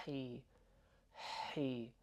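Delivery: hi with a strong breath on the H; breathy H in hi. Shown as a demonstration. Teacher showing learners the capital H in hi, breathy. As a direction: push breath through the H of hi. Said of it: "it kind of sounds like this".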